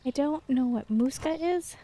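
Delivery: ordinary talk, nothing marked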